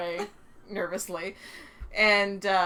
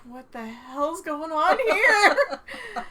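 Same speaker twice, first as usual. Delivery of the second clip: high, loud, slow stunned cry with a laugh in it; acting out her disbelief, drawn out; shocked outburst; 9 semitones higher, 9 dB louder, much slower than usual. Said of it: "She was like".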